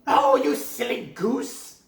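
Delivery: high loud scornful witch; mocking witch. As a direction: sneer at the silly girl. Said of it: "said the old woman".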